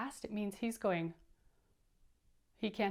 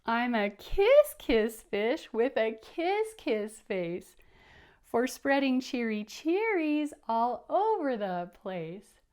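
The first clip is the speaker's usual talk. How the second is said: cheery fish; 7 dB louder, slower than usual. Acted. Happy fish sings refrain.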